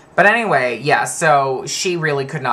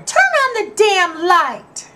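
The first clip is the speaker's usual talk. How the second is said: put-on high, slow child voice; a four-year-old saying it; little cousin; 5 dB louder, slower than usual. Small cousin demanding the light, high and slow. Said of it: "I remember my cousin going".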